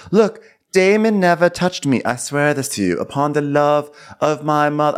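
play-acted solemn vow; earnest mock oath. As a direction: protest innocence with hammed-up sincerity. Acts out princess swearing innocence.

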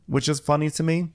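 ordinary talk, nothing marked

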